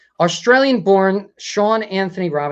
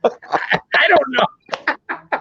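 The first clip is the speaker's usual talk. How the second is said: laughing; 8 semitones higher, 6 dB louder, much slower than usual. Real, not acted. Laughing at own attempt.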